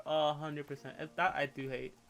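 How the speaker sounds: usual speaking voice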